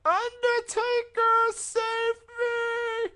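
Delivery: high, slow, loud desperate wail; trapped in cement, screaming falsetto for rescue; Paul Bearer impression. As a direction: beg the Undertaker, then curse Heyman in a shriek. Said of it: "and he was just like".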